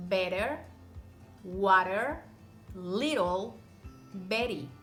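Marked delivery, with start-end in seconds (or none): none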